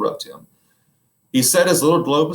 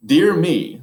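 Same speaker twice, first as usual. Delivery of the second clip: high, loud shocked gasp of words; a prim wife scandalized; 8 semitones higher, 8 dB louder than usual. Acted. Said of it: "in a shocked tone".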